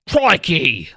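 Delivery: "Crikey" said as /kʰɹɔɪki/ with a broad Australian "oi", punchy and loud; a mock Australian "Crikey" thrown out firmly; Australian accent. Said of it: "yeah, accent".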